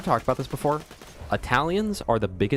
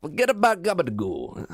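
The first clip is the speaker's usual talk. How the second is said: mock Italian; 7 semitones higher than usual. Continuing Italian bit.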